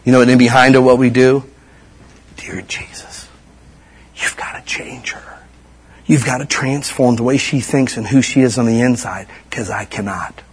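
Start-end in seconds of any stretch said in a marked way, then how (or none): 2.4-10.4 hushed, breathy plea; whispered prayer. Retold or imitated. Parent praying for a daughter, whispered.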